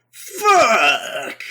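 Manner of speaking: high, drawn-out wail of defeat; mock anguished cry. Recapper plays Kaiba losing the duel, mock anguished cry. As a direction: imitate a sore loser howling one word. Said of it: "So he's like".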